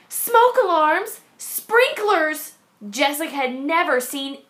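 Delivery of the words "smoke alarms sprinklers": high, slow, alarmed questions; a girl realizing her home lacks these. A narrator's reading. Jessica alarmed at the teacher's list, high disbelief. Child alarmed by missing safety gear.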